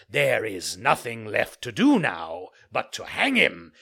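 dark realisation; sinister. Acted. Assassin decides to hang him.

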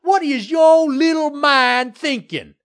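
high, slow, furious shouting; furious screaming. A narrator's reading. Developer screaming at the mayor about the galleon, furious. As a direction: scream each word out in outrage. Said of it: "screamed at Mayor".

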